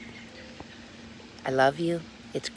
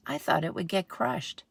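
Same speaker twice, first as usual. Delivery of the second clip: ordinary delivery, nothing marked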